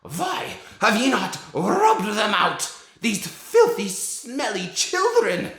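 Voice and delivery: put-on very high, loud, slow screech with a Slavic accent, rolled R's; a dad screeching the witch's lines for his kids; Grand High Witch. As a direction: screech it out like a furious witch queen. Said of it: "here's what it sounds like when you read The Grand High Witch".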